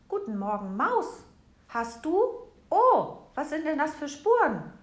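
high, loud, excited squirrel voice; a chirpy little critter calling across the snow. A narrator's reading. Squirrel calls good morning to the mouse, high and excited. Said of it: "Da rief auch schon das Eichhörnchen".

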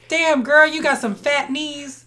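high, loud opening, stretched ending; playful catcall breaking into laughter; mock catcall. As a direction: holler it like a joking catcaller. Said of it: "don't catcall people on their knees".

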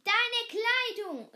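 high, loud shout; dad calling her back; calling after. A narrator's reading. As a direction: shout after the running girl. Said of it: "rief mir".

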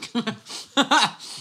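high cackling burst of laughter; loud laugh. Real, not acted. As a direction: crack up loudly. Laughs at own joke to Woodward.

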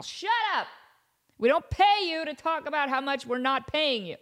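high-pitched mock outrage; hammed-up angry boss; bosses snapping at intern. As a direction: cut him off, high and indignant.

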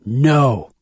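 shouted refusal. A narrator's reading. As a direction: shout to stop someone. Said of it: "Conrad shouts".

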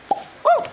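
loud high whoop; excited woo. Real, not acted. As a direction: whoop after the shot. After taking the shot.

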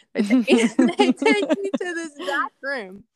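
real laughter riding on the words; laughing. Real, not acted. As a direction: restart the line while cracking up. Laughs at her VIP treatment remark.

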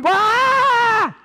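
high frightened scream; loud high shriek; disciple screaming. Retold or imitated. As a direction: scream in terror at the ghost. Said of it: "John was looking over at Peter".

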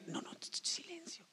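whispered shushing; hushing whisper. Retold or imitated. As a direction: hiss to hush him. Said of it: "le dicen".

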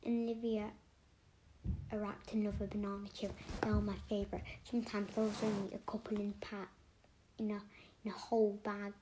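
ordinary delivery

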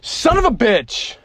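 slow loud curse; angry shout. Real, not acted. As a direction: bellow it out. Drink spilt in car.

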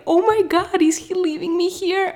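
high, panicky little-girl voice, imitating her younger self; a scared four-year-old suddenly left alone on the slope; freaked-out child. Four-year-old alone atop an icy slope, voicing retold panic. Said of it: "I was freaked out as a kid".

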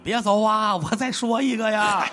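kneeling, pleading high and slow; mock begging actor. Actor on his knees begs audience, high mock pleading. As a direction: beg the leaving crowd to stay, hammed-up.

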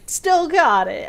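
high, loud, slow smug boast; smug Stan. Retold or imitated. Stan boasting in his boxers as the kids flee. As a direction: preen like a proud old man, a hint of Stan. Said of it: "Stan's like".

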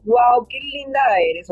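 slow, drawn-out admiring wow; gushing gratitude. Acted. Friend savours the gift of a flower, gushing gratitude. Grateful receiver gushes over flower.

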